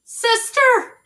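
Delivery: high, loud cry for her sister; frightened shout. Acted. Baby shouting for her sister in fright. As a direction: call out in panic. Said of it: "shouted Baby".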